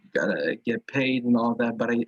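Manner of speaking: usual speaking voice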